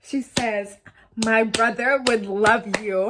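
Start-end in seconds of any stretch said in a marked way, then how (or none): 1.2-3.0 put-on slow, oddly changed voice of a tipsy girl; drunk sister. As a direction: drag it out like you've had too many.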